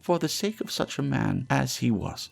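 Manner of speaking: high charwoman voice, a hint of coldness; lightly cold, dismissive old woman; coldly. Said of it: "returned the woman coldly".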